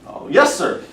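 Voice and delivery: high, loud, innocent junior officer; Captain Yossarian, eager to answer. Acted. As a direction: pipe up helpfully to a superior. Nervous captain answers angry colonel.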